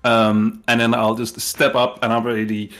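ordinary delivery

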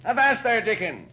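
put-on captain spotting old Dickon, loud hail; calling out. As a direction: hail him across the room. Captain hails old Dickon across room.

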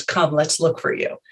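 ordinary delivery

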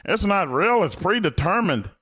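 high, sing-song mock voice of skeptics; mocking wrestling doubters. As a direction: sneer it up high like a know-it-all fan. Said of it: "And people always talk".

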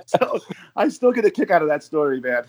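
laughing. Real, not acted. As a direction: laugh your way through the words. Laughing at his own story.

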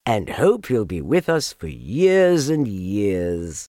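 ordinary delivery, nothing marked

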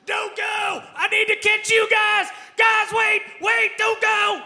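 high, loud desperate yell; screaming. Acted. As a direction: scream after them to wait.

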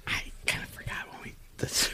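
quick sheepish whisper. Real, not acted. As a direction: rush it out under your breath. Sheepish fast admission.

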